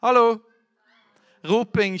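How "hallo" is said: loud, high, sudden shout; a big raised-voice hello; calling out. Shown as a demonstration. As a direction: holler it to get everyone's attention. Calls out to show volume.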